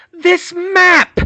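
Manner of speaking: high, very loud shout; yell. Acted. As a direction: yell it in her face. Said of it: "she yelled in her face".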